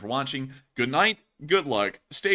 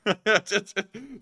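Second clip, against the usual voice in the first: high laugh after a fright; nervous laugh; 8 semitones higher than usual. Real, not acted. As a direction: laugh it off, relieved.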